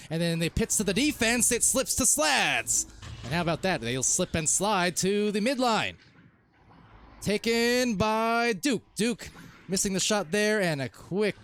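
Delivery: drawn-out, lifted sports call; lively esports commentary; live play-by-play. Acted. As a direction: stretch the key words like a commentator.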